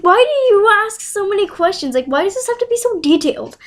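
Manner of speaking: high-pitched, exasperated questioning; acted-out indignant protest; exasperated retort. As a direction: whine back at a nosy teacher. Retold annoyed reply to teacher's questions.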